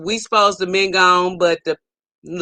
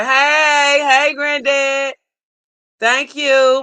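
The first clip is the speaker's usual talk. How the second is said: long, drawn-out hey; happy stretched-out holler; calling out greeting; 3 semitones higher, much slower than usual. Real, not acted. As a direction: hail a friend, stretching the words. Greets a viewer joining the stream.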